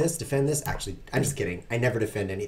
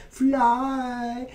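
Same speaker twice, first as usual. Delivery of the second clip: excited; 11 semitones higher than usual. Pretend. Making his piece fly.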